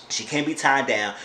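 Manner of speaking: usual speaking voice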